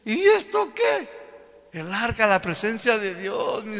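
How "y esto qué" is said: high, slow, booming God voice; God scoffing at the idol, high and slow. Acted. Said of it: "Y el Señor mira y dice".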